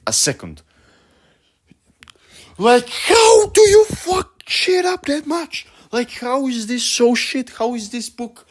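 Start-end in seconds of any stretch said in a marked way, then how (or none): 2.6-5.7 high, loud incredulous yell; ranting at full pitch in exasperation; exasperated shout. Real, not acted. Exasperated rant about the book. 5.9-8.4 high-pitched, strained incredulity; high-voiced, bewildered complaint; exasperated disbelief. Real, not acted. Exasperated rant about the book.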